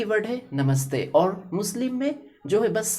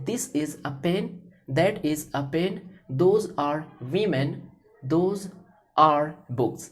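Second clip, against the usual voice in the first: slow teaching demo; much slower than usual. Shown as a demonstration. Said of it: "इस तरीके से मैंने is".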